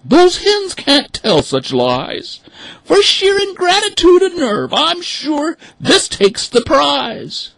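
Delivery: high, loud, slow outraged duck; high indignant mother duck. Acted. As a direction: rail against the hens as a mother duck. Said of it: "the mother cried".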